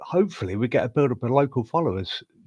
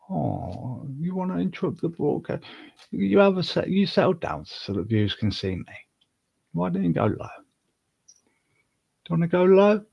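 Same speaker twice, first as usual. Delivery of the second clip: coaxing the cat; 6 semitones higher than usual. Real, not acted. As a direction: coax the cat sweetly.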